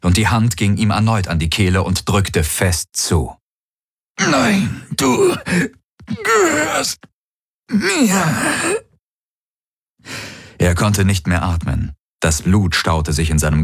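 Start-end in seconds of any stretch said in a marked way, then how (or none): 4.2-8.9 word by word, extremely high choking voice; strangled. A narrator's reading. Galen is throttled by his own hand, strangled. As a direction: gasp out each word through a crushed throat.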